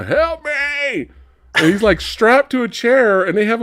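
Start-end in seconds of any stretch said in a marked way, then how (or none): 0.0-1.1 high imitated plea for help; mock cry for help. Retelling the trapped uncle calling out, high mock plea. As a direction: squeal it like a tied-up old man. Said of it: "He's all".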